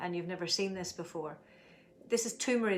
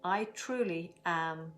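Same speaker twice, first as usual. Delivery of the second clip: slow calm affirmation; much slower than usual. Shown as a demonstration. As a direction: say it slowly, letting calm settle. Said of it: "let's make our statement".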